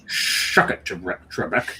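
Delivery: slushy Scottish accent impression; hammed-up Scottish delivery with hissing sh sounds; Sean Connery. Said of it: "That's the only Sean Connery I do".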